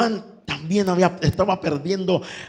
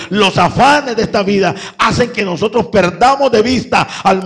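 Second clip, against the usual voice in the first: louder, driving pulpit delivery; impassioned preacher in full flow; full-force preaching; 4 dB louder than usual. Real, not acted. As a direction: build the exhortation in forceful bursts.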